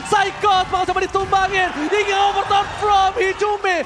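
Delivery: excited shout. Real, not acted. Caster hypes a disaster play.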